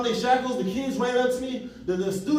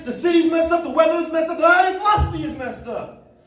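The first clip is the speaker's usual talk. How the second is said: high, louder mock complaining; mock the people who blame everything; mocking complainer; 6 semitones higher, 5 dB louder than usual. Speaker mocking neighbors who blame the city, high and louder. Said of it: "You know what they're gonna say?".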